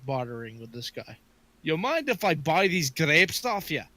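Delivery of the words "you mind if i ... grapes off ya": lightly put-on Scottish accent; casual Scottish brogue; Scottish dwarf. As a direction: open a bargain like a Scot. Dwarf bartering in character.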